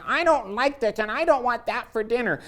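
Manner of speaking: put-on high-pitched whiny child complaint; whining kid. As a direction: whine like a kid refusing dinner. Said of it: "if they're whining".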